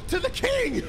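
very high, straining victory yell; triumphant cry. Real, not acted. Player as the boss falls, triumphant cry. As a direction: cry it out at the top of your voice.

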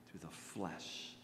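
normal talking voice

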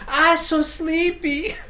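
slow, high mock Russian accent; comic sleepy Russian, drawn out. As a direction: put on a hammed-up Russian accent, half laughing. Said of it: "That was my Russian accent".

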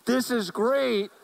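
high, gloating mock pastor; a proud pastor admiring the tears he caused; smug pastor. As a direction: play the self-pleased preacher. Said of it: "me as a pastor, I used to rejoice".

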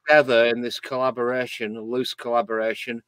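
usual speaking voice

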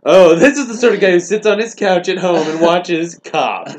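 mock judgmental voice. Mocking what people think.

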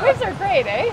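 high, bright Canadian "eh?" tag; Canadian accent. Shown as a demonstration. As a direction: show how Canadians end with "eh". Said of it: "That's how to use A in a sentence".

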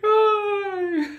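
high strained wail; a cry torn out of him; anguished cry. Acted. Jilted actor wails at the star who laughed.

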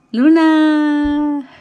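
loud, high, drawn-out name; excited reveal. Acted. Mom ending peekaboo with baby, loud high happy call. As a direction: cheer her name as you reveal yourself.

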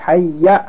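"हय्या" with a held, pressed yy; firm doubled y. Shown as a demonstration. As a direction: lean hard on the y of "हय्या", then release. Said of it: "हय्या नहीं पढ़ना है".

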